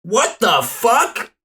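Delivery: drawn-out stunned what the fuck; slow, loud disbelief; shocked. Acted. Player shocked by car.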